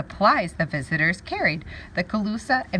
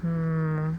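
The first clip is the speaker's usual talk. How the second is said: usual speaking voice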